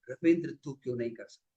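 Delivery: low, soft, quick self-talk; hushed question to oneself; questioning himself. Retold or imitated. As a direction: ask yourself privately, voice dropped. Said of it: "अपने आप से सवाल पूछा".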